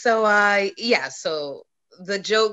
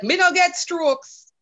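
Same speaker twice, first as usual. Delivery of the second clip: slow, higher mock tough-guy voice with a laugh; dad's tough-guy boast; 6 semitones higher, 4 dB louder, slower than usual. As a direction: drawl it out like a stubborn macho dad. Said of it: "and he's like".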